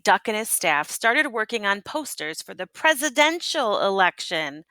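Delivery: usual speaking voice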